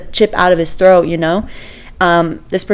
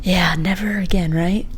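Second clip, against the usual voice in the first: breathy whisper, almost no voice; whispered aside; 8 dB quieter than usual. Real, not acted. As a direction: drop to a whisper as if sharing a secret. Wry aside to sister.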